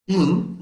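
In said alone and high, pulled out of Interesting; teacher models the syllable In; syllable demo. Shown as a demonstration. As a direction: isolate the first syllable In, high and clear. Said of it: "Esta parte de aquí se pronuncia".

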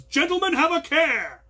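high, loud call; rally cry. Retold or imitated. Ren Fair troop calls out its rally cry to the company. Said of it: "their rally cry was".

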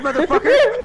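high, loud burst of exasperation; exasperated gamer yell; angry shout. Real, not acted. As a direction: yell it at a bungling teammate. Raging at teammate in game.

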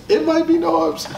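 sudden jump way up in pitch; teasing high-pitched repeat; high squeaky repeat. Shown as a demonstration. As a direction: toss the name out in a high put-on voice.

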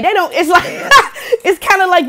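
high, laughing through the words. Real, not acted. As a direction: crack up while talking. Laughing at own impression.

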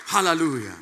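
ordinary delivery

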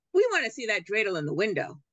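fast, pushy demand; brisk demand. Acted. Mother demands to see the dreidel, brisk and pushy. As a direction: rush the shopkeeper along. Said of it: "the woman demanded".

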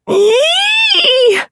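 very high shrieking squeal; frightened squeal. Acted. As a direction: squeal in panic.

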